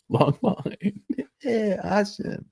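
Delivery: high-pitched, laughing through the words. Real, not acted. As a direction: crack up while talking. Laughs through own joke.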